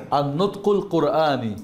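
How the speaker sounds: slow, careful Arabic "আর নুতকুল কোরআনি"; Arabic pronunciation demo. Shown as a demonstration. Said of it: "আমার সঙ্গে বলেন". (ধীরে আরবি উচ্চারণে "আর নুতকুল কোরআনি")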